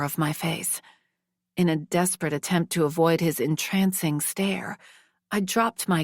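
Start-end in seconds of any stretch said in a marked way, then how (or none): none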